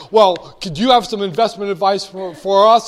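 a hammed-up eager fan begging for tips; eager asker. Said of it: "People say".

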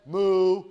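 a loud, playful moo; mock bull moo. Teacher voicing the bulls on the chart, loud mock moo. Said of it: "The bulls came in and they said".

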